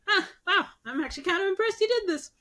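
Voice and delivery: high, quick surprised god; Sky God pleasantly surprised at the trickster; impressed Sky God. Retold or imitated. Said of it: "The Sky God is like".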